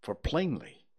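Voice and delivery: usual speaking voice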